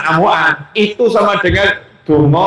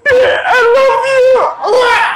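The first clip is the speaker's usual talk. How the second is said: very high, slow, laughing gush; swooning husband; much slower than usual. Acted. Husband swooning at his wife, high and slow. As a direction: melt and gush it out. Husband melts at wife's words.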